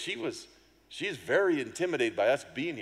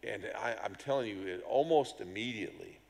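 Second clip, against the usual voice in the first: ordinary talk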